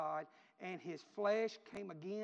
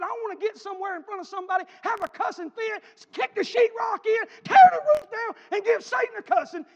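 high screamed rant, building to a peak; blow-apart fit; 19 dB louder than usual. Retold or imitated. Son telling mama he wants a fit, ranting scream. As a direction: let it all explode at full volume.

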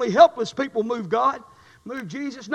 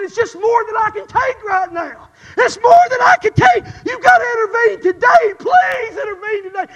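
loud tearful plea, voice breaking; retold crying prayer; 8 semitones higher, 11 dB louder than usual. Preacher reliving his crying prayer for his mother. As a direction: beg God through tears. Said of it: "I said".

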